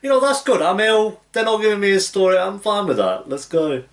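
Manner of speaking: high, loud emotional outburst; worked-up reaction. Real, not acted. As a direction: cry it out, pitch easing down toward the end. Heated reaction to episode.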